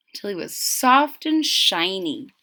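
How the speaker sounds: ordinary delivery, nothing marked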